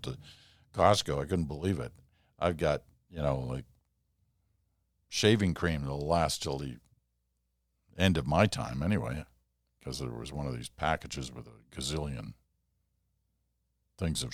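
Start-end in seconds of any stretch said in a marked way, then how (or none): none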